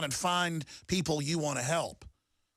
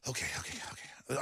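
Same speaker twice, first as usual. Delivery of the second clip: calming whisper; 5 semitones lower, 9 dB quieter than usual. Real, not acted. Host calming himself after a rant, in a whisper. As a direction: whisper it to calm yourself. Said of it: "I've learned not to get mad".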